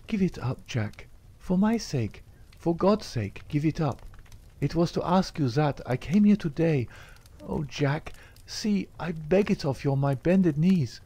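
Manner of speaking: high, begging voice; Etty, pleading desperately. A narrator's reading. Etty implores Jack to quit the lodge, desperately pleading. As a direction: beg him on your knees.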